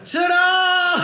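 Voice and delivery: hammed-up big finish; mock triumphant ta-da. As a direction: present it like a stage bow. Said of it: "That's all I have for this character".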